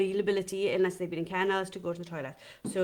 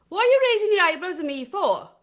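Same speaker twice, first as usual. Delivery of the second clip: put-on indignant kid's protest, higher and louder; cheeky son; 8 semitones higher, 7 dB louder than usual. Imitating her son protesting at her raised eyebrows, higher and louder. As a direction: act the affronted little boy. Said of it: "he'd go".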